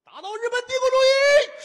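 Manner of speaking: very high, strained slogan yell; 尖声高喊口号. Acted. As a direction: scream the slogan at the top of your range. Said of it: "什么乱七八糟的？". (拔高嗓门尖声喊口号)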